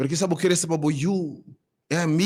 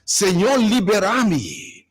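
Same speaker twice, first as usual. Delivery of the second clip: fervent preaching; 5 semitones higher than usual. Acted. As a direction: preach it over the congregation with rising fire. Pastor preaching a prayer on air.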